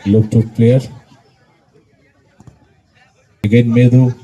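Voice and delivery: loud English play-by-play; frantic football commentary. Real, not acted. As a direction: shout each pass. (উত্তেজিত football ধারাভাষ্য)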